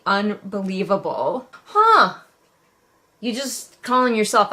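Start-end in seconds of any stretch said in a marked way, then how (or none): 1.7-2.2 sudden high, loud huh of disbelief; a startled scoff at the page; surprised huh. Real, not acted. Reviewer catching the author's line, surprised. Sudden reaction while reading aloud.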